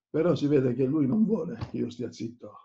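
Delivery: normal talking voice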